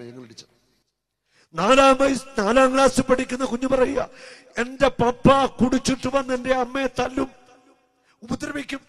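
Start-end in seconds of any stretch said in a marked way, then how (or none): 1.5-8.8 loud, high, slow pulpit cry; a slow, loud sermon call; preaching at full force. Acted. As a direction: drive each duty home to the congregation. Preacher repeats child's words loudly.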